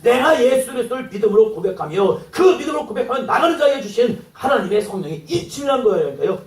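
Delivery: ordinary talk, nothing marked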